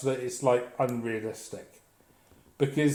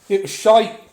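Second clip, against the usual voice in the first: higher, louder blurted put-down; blunt verdict; 7 semitones higher, 9 dB louder than usual. Retold or imitated. Telling the mate his book, blunt loud verdict.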